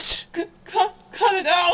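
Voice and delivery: high loud crying shout; being tickled. Acted. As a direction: squeal it out.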